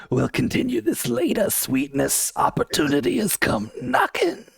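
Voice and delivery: creepy snake-villain voice, pitched high. Acted. Reader doing a comic-book line on request, snake voice. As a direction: slither through it like a cartoon snake. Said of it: "do that line in the snake voice".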